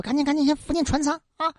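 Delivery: lady urging her servants in panic, quick and hushed; hurried urgent order. Acted. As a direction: rush the servants, breathless with alarm.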